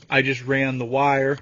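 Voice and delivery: ordinary talk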